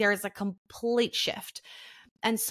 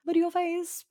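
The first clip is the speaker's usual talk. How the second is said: much higher, lilting luteal phase; sing-song reminder; 10 semitones higher than usual. Shown as a demonstration. Partner reminding himself to stay calm, sing-song. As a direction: chime it like a cheerful mantra. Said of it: "in your head you just go".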